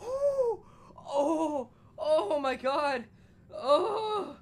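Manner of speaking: high pained yelps; yelping. Real, not acted. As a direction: yelp through the pain. Still yelping in pain.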